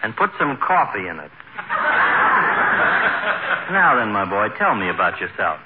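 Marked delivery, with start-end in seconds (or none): 1.3-3.6 high breathy laugh at his own joke; doctor chuckling at his quip; doctor's chuckle. Acted. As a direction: laugh at your own gag.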